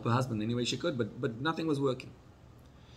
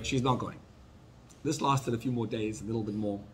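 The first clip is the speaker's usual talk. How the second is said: usual speaking voice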